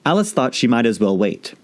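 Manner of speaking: ordinary delivery, nothing marked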